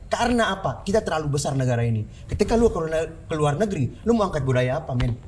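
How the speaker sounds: ordinary delivery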